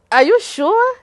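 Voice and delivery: high, loud doubting question; incredulous. Retold or imitated. Questioning the doctor's news, high and incredulous. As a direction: ask it in pure disbelief.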